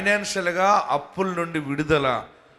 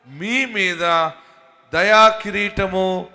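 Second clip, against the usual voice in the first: slow, louder, weighty words; slow emphatic blessing; 5 dB louder, slower than usual. Real, not acted. Preacher pronouncing blessing on the people, slow and emphatic. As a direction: lay each word down slowly.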